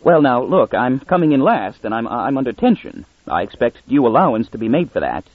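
ordinary delivery, nothing marked

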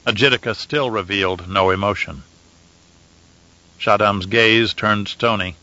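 plain reading voice

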